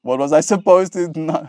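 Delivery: usual speaking voice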